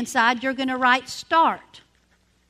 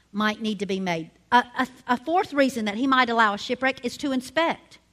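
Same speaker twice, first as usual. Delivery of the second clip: normal talking voice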